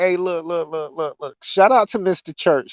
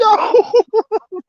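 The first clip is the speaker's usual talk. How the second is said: high wheezing laugh; 4 dB louder than usual. Real, not acted. Cracking up at friend's joke.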